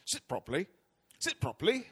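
imitated dad correcting a slouching kid; retold scolding parent. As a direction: play a father nagging about posture. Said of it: "One of the things I heard".

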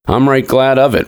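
put-on high, whiny old man; whining old man. A narrator's reading. Old man grumbles to nurse and father, whining. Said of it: "whined the old man".

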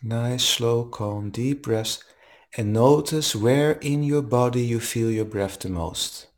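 calm, even meditation guide voice; guided meditation. Acted. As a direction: guide them gently into the breath. Leads a guided breathing meditation.

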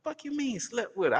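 high, incredulous question; stunned high retort; shocked question. Retold or imitated. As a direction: ask in disbelief. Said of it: "I said".

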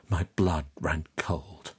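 ordinary delivery

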